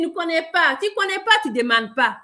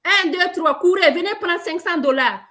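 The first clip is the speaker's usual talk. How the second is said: raised, higher calling-out voice; mock race call; 5 semitones higher, 3 dB louder than usual. Seller imagining a prize race for the breathless, called out. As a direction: call out a starting count to a crowd. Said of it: "Imagine voir quand on dit".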